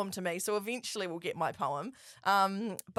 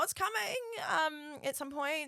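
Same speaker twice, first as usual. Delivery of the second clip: mock wail; 8 semitones higher, 3 dB quieter, slower than usual. Pressed about the overdue review.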